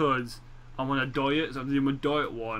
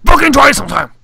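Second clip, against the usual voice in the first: explosive high-pitched yell; sudden shout; 9 semitones higher, 18 dB louder, faster than usual. Real, not acted. Snapping at a friend who doesn't eat cereal, shouting. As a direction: burst out very loud and high.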